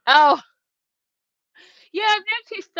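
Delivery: ordinary talk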